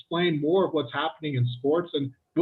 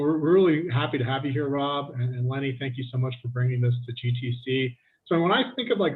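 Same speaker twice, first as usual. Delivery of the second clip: normal talking voice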